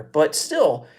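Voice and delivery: usual speaking voice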